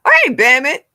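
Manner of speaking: loud, high call-out; high, punchy shout of welcome; excited greeting. Real, not acted. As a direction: holler a hello to a buddy. Calling out to an arriving viewer.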